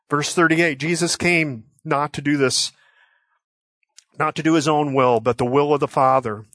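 normal talking voice

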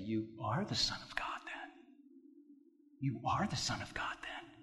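very soft, low, near-whispered quote; hushed questioning. Retold or imitated. Retold council questioning Jesus, hushed.

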